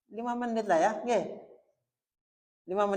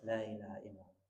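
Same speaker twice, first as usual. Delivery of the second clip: La ilaha illallah dilafalkan lirih dan rendah; light soft recitation; 11 semitones lower, 13 dB quieter than usual. Shown as a demonstration. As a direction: lafalkan La ilaha illallah pelan dengan bibir terbuka. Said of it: "Itu ringan ya". (La ilaha illallah dibaca lirih)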